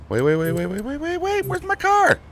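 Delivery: startled disbelief at a missing car; alarmed wait. Real, not acted. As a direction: voice shoots up as the car is gone.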